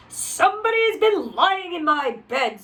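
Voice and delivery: a touch of gruff big bear; mildly gruff papa bear; great huge bear. A narrator's reading. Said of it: "in his great rough gruff voice".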